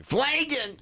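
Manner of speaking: squeaky, very high cartoon cry. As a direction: shoot up into a silly falsetto. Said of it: "and go".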